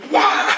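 explosive mock "wah"; imitated punch noise. Storyteller imitating the fist strike, explosive punch noise. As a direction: voice a TV-show punch with a laugh. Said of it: "He takes his fist and goes".

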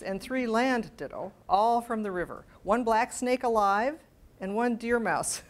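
precise, measured letter reading; letter read aloud. Acted. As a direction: read out the list carefully, like a period letter. Reads Thoreau specimen letter.

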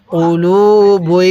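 "কুলুবুই" drawn out very slowly, each syllable held; slow model reading. Shown as a demonstration. Teacher reads the joined word slowly for students to repeat. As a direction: model the word slowly so learners can copy it. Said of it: "কীভাবে শিখব?".

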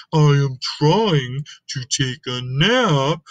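ordinary delivery, nothing marked

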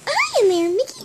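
very high cartoon mouse squeak; Minnie Mouse. Friends calling to Mickey, squeaky mouse voice. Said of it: "Now we're gonna do Minnie Mouse".

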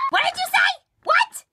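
high, loud, indignant challenge; offended shriek. Acted. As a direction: demand it in a piercing rising shout. Offended at friend's boast.

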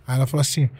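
usual speaking voice

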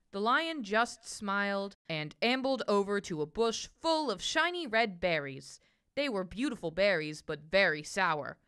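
usual speaking voice

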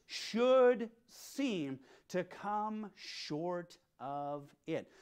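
"should seem to come short" drawn out very slowly, each word weighted; slow stress on should seem. Shown as a demonstration. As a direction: lean on "should seem", stretching every word. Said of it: "don't miss these next two words".

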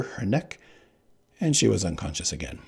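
usual speaking voice

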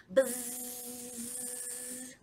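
ordinary delivery

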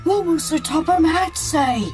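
high, slow, worried gasp. Acted. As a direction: gasp it in worry. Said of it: "gasped Percy".

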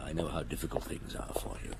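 whispered, gentle and low; quiet confiding whisper; soft whisper. Acted. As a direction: hush it to coax the boy. Detective gently coaxing a boy.

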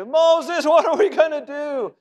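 high, loud, panicked plea to Moses; panicked cry. Retold or imitated. The Israelites crying out to Moses in panic, high and loud. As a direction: throw it up in alarm like a frightened crowd.

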